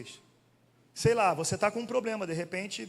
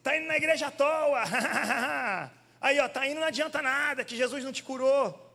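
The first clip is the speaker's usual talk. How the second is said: mocking taunt; 7 semitones higher, 3 dB louder than usual. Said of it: "a pessoa chega pra você e fala assim".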